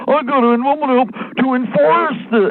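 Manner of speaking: high prissy mock voice; mock enforcer. Mocking a police state wannabe in a high prissy voice. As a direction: ham up an eager little enforcer. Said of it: "police state wannabe mindset".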